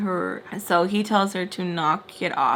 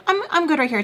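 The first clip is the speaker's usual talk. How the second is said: high, sing-song brush-off; breezy refusal; 8 semitones higher, 5 dB louder, much faster than usual. Retold or imitated. Daughter refusing to move for her father, breezily defiant. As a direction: mimic a defiant daughter declining, pitched up.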